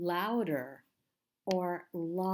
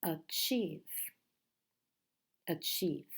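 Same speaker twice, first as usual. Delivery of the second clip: "Achieve" with the stress on chieve; stress second syllable; 2 semitones lower than usual. Shown as a demonstration. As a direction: say "Achieve" weak on a, strong on chieve. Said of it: "the strong syllable, is the second".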